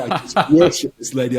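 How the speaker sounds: real laughter breaking into the line; laughing. Real, not acted. Laughing at own memory.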